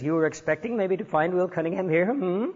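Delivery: playful mock Indian accent; imitating an Indian accent as a joke. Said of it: "funny Indian accent imitation".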